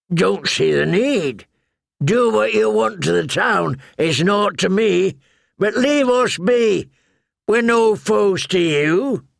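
put-on a weary old crow speaking; gruff old Yoren. A narrator's reading. Yoren answers the knights.

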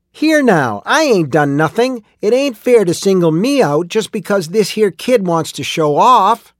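high, loud squawking protest; indignant squawk. A narrator's reading. Miner protesting being singled out, indignant squawk. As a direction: squawk it out high, as if unjustly accused. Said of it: "Ollie Ang squawked".

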